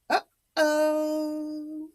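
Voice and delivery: hint that trouble is coming; knowing uh-oh. Acted. Aside hinting trouble.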